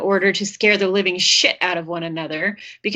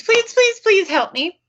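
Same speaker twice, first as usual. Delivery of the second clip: higher, louder mock panicked begging; panicked pleading; 10 semitones higher, 3 dB louder, slower than usual. Babysitter begging the dispatcher for help, in mock panic. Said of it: "goes into panic mode and pleads".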